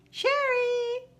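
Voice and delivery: a long high call for a missing viewer. Real, not acted. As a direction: call her name up high like across a room. Calling out for Sherry.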